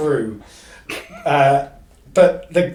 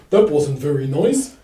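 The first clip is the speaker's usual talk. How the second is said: retelling the dog. Retold or imitated. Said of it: "Ember kind of goes".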